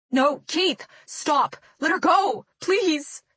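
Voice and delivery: high, loud frantic begging; desperate pleading shout. A narrator's reading. Choking girl screaming at her attacker to stop. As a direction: scream at him to let her go. Zoe begs Keith to let go.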